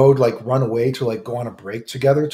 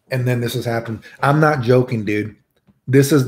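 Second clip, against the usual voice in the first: usual speaking voice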